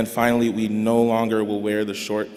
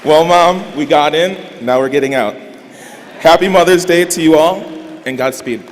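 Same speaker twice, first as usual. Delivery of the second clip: much louder and higher, calling out in celebration; joyful shout; 6 semitones higher, 11 dB louder than usual. Real, not acted. Graduate calling out to his mom, loud joyful shout. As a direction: shout it out to the whole hall with joy. Cheering close to graduation speech.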